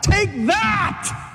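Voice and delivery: high, triumphant yell; vengeful shout. Acted. Preacher imagining wiping out the mockers, high shout. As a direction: yell it high like a final blow.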